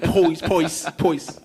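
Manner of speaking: "poise" with a strong Brooklyn oy; hammed-up Brooklyn "poise"; Brooklyn accent. Shown as a demonstration. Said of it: "my Brooklyn accent comes out".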